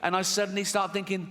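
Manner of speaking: normal talking voice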